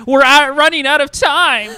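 high, loud scream about time running out. Acted. Organizer warns the team that time is gone, screaming. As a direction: scream it like a frantic timekeeper. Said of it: "point out my watch while screaming".

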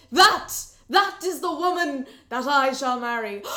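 put-on both boys shouting their claim; loud cry. Two rivals shouting at once over the woman. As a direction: cry it out, smitten.

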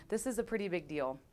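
usual speaking voice

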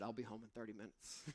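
soft, quick sheepish reply; quietly sheepish husband. Retold or imitated. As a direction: mumble it, caught out. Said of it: "responded and said".

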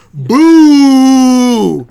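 loud mock crowd boo. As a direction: boo her like the whole arena. Crowd booing the wrestler.

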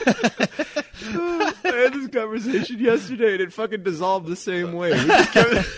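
high, loud words breaking into laughter; real helpless laughter under the words; laughing while talking. Real, not acted. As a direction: try to talk through a laughing fit. Cracking up at the jokes.

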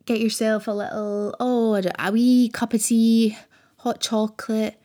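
usual speaking voice

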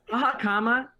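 loud and high, annoyed; irked. Shown as a demonstration. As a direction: say it like it grates on you. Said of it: "it irks me a little bit".

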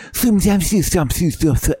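high, lisping mock-English gibberish; English as foreigners hear it. Showing how English sounds to Russians, mock-English gibberish. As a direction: babble English as all TH sounds. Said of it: "what English sounds like to them".

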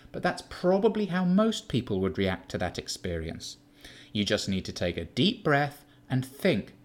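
put-on calm him like an exasperating child; condescending Keith. Keith lectures Sean about emotions, cold and superior.

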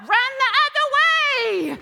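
high, loud, slow cry of run the other way; emphatic shout. Real, not acted. Preacher urging the congregation to flee temptation, loud and high. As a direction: belt it out to the crowd.

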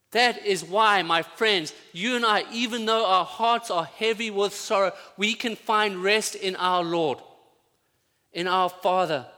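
high, loud, full-force preaching; fervent preaching. Acted. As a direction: preach it out to the whole room.

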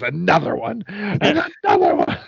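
mock rant jumping suddenly high; hammed-up repeat, second one squealed; imitated wrestler frenzy. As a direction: imitate a wrestler escalating wildly. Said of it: "he rips, he goes".

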